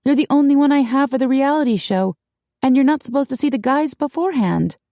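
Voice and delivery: high-pitched, urgent objection; insistent. A narrator's reading. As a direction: press the point anxiously. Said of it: "Darcy insisted".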